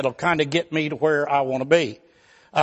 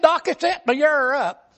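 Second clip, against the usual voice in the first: high, tearful whine about pain; whimpering hypochondriac; 7 semitones higher than usual. Retold or imitated. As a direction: whine like every touch is agony. Arzy complaining.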